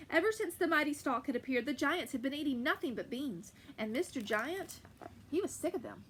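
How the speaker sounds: ordinary delivery, nothing marked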